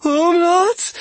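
very high, loud rising question; frightened, shaking. A narrator's reading. Rabbit tries the word for the steward, terrified. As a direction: venture it as a terrified squeak. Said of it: "her entire body shaking".

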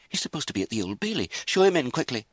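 hurried, high and urgent; anxious. A narrator's reading. Lizzie urgently sends for the pastor, anxious. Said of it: "Lizzie said anxiously".

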